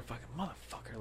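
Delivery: very quiet grumbled swearing; imitating a driver's low grumble; muttered curse. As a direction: curse under your breath, barely audible. Overheard driver cursing under breath.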